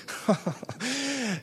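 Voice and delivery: a high burst of retold laughter; short high imitated chuckle. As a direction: mimic the old man's sudden laugh. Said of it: "he laughed".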